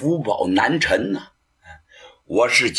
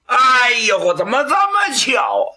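amazed exclaim; 9 semitones higher than usual. Acted. Qin Qiong amazed to find his uncle, crying out. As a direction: marvel at the coincidence.

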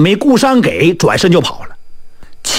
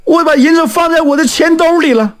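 high, fast, flustered answer; panicked; 10 semitones higher, faster than usual. Acted. Newcomer answers fortune-teller about the silver, panicked. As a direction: blurt it out anxiously. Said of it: "这个人是惊慌万分".

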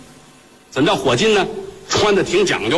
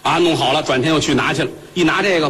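ordinary delivery, nothing marked

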